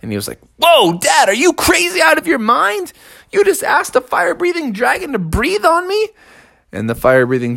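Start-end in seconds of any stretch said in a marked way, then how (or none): 0.6-6.1 high-pitched outraged protest; indignant cub. Acted. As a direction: squeal at Dad in disbelief. Cub protests being set alight.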